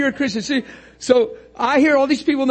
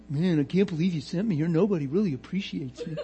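low, softened mock grumble; lightly hammed-up self-pity; whining Jesus; 5 semitones lower, 6 dB quieter than usual. Imagined Jesus grumbling to his Father, mock whine. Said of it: "could you imagine God talking like that or Jesus talking like that".

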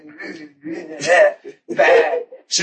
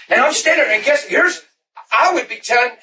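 ordinary delivery

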